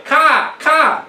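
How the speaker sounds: loud high cawing crow; shrill crow call, high and loud; crow caws. Retold or imitated. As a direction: caw out like a crow. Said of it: "the crows say".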